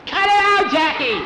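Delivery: high, loud squeal of protest; indignant yell. Acted. Boy yelling at teasing Jackie, high squeal. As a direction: shriek at him to stop.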